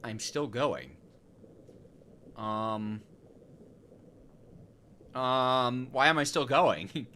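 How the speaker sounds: ordinary talk, nothing marked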